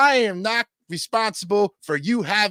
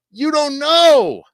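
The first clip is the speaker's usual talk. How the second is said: louder and higher, dragging You don't know out slowly; loud slow outburst; 7 semitones higher, 9 dB louder, slower than usual. Real, not acted. Ranting at trolls who doubt him, a loud slow shout. Ranting at critics on his show.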